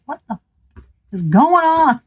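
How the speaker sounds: rising frustrated cry; exasperated outburst. Retold or imitated. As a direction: demand what is going on, exasperated. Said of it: "being like".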